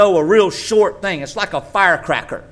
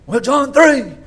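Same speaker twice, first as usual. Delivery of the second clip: mock objector; 10 semitones higher, 8 dB louder, slower than usual. Mocking objector.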